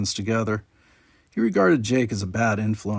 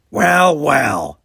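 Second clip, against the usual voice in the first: put-on louder, knowing drawl of an older alien; 4 semitones higher, 9 dB louder than usual. A narrator's reading. Quark greets his nephew and Jake, a louder smug Ferengi voice. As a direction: tease the boys with a grin.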